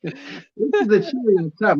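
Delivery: talking through laughter; can barely get the words out for giggling; cracking up mid-sentence; laughing. Real, not acted. Teller cracking up at punchline.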